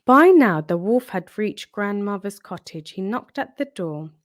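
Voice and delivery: ordinary delivery, nothing marked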